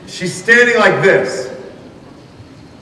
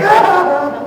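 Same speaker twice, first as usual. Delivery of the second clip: high-pitched mock squeal of delight; happy squeal. Happy man standing at the back of the show, high delighted squeal. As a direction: imitate an overjoyed onlooker, way up high.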